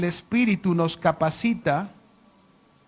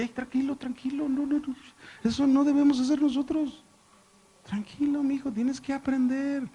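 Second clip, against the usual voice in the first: calming the son; 7 semitones higher than usual. Retold or imitated. Father calming his angry son.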